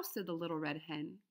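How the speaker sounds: plain reading voice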